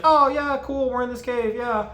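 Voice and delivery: a hint of a British caver; faint mock British accent. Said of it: "I'm gonna stop doing that".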